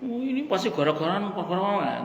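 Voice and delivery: high, soft mock complaint; mock lamenting. Preacher mimics a complainer for the mothers, mock lamenting. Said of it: "Tidak meratapi keadaan".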